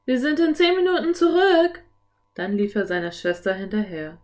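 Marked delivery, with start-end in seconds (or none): 0.0-1.8 high, loud shout back into the house; calling out. A narrator's reading. Philipp calling through the open front door, high loud shout. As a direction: call out so they hear you indoors. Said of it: "rief durch die offene Haustür".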